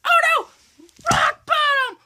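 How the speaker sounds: high shouted wrestler call; Rock yelling. Retold or imitated. Kid imitating The Rock calling his finisher, high yell. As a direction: yell the move name like The Rock. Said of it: "The Rock goes".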